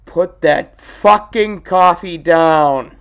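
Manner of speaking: loud, slow, drawn-out command; hammed-up movie boss yell at a salesman; mock shouted order. Glengarry quote, shouted.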